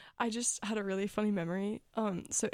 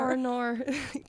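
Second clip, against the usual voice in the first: higher mock Australian accent; 4 semitones higher, 3 dB louder than usual. Joining in her friend's teasing, playful Australian accent. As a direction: echo it with broad Aussie vowels. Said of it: "I think it was Australian".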